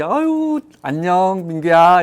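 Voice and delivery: high, loud sing-out hello, imitating a kindly teacher; friendly teacher greeting. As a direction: greet a little child with gushing, exaggerated friendliness. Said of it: "너무 친절한, 언니 같은 분들". (친절한 언니처럼 높고 크게 반기는 말투)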